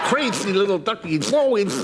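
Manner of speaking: usual speaking voice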